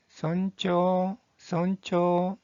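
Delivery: 손초 very slow, final vowel held; pronunciation demo. Shown as a demonstration. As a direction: model 손초 slowly for learners. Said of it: "이 한자의 발음은".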